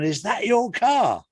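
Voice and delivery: high, drawn-out amazed question; impressed mate. Acted. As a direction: marvel at your mate's car, slow and high. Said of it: "and his mate's gone".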